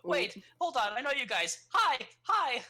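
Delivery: put-on high, eager little child's voice; a small child recognizing friends; small kid voice. GM voices the small half-orc kid.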